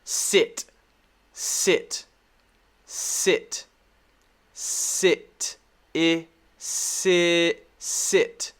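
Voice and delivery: "sit" said alone with a short "ih"; short I demo. Shown as a demonstration. Teacher modelling short I for learners, careful isolated "sit". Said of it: "this is the short I sound".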